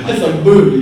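loud laughing burst; real laugh through the word. Real, not acted. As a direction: laugh out the punchline. Laughs at the mic drop.

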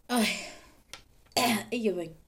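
choking on a cough; coughing, then catching breath; coughing fit. Real, not acted. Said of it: "engasguei-me".

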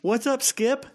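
high, friendly mock greeting; imitating paraglider guy. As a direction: greet the kangaroo in a high, goofy pal voice. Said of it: "he's like".

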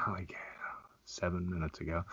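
whispered aside; sly whispered reply. Real, not acted. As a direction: breathe the answer, voiceless. Teasing aside.

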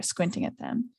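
usual speaking voice

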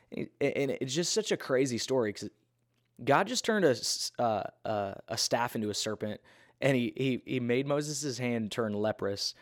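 ordinary talk

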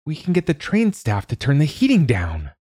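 put-on high bright girl's idea; a girl sure she has the answer; eager child. A narrator's reading. Girl proposing a fix to Dad, high and eager.

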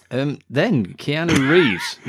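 ordinary talk, nothing marked

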